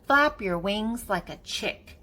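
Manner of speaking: usual speaking voice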